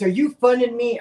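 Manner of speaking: loud, high-pitched imitation of an angry countryman; retold angry outburst, voice jumping up; mad old man. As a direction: bark it like an offended old man. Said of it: "he was all mad".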